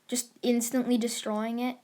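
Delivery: ordinary delivery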